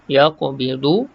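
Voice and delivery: yaqbidu slow, q echoed; qalqalah. Shown as a demonstration. Teacher demonstrating the bouncing letter. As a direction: echo the q in yaqbidu. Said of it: "qalqalah ... lantunkan".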